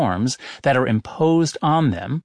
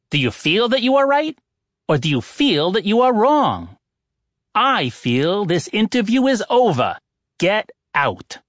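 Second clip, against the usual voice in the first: sharp, higher, impatient oracle; tart; 7 semitones higher than usual. A narrator's reading. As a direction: dismiss the visitors curtly. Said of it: "Misoponos responded tartly".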